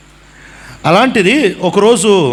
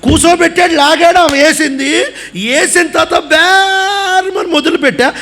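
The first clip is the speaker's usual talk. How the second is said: laughing; 8 semitones higher than usual. Real, not acted. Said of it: "laughing while retelling crying".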